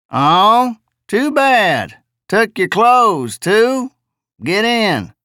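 high, drawling simple-minded voice; drawly jejune voice. Acted. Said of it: "drawly jejune voice".

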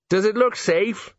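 frightened man dangling from a roof; nervous question. A narrator's reading. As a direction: ask it fearfully, clinging on.

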